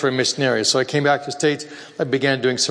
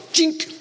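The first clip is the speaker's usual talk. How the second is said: ping it like steel on steel. Retold or imitated. Imitating axe striking ironwood.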